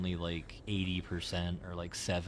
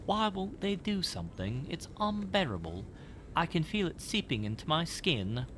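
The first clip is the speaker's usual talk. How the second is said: distressed tenant; 9 semitones higher than usual. Acted. Voices distressed note writer.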